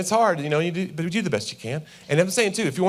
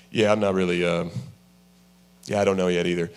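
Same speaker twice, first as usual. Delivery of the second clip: much lower, hesitant mumble; low stalling husband; 9 semitones lower than usual. Retold or imitated. Husband stalling his order, low and hesitant. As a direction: drop the voice way down and stall. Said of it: "husband answering in standoff".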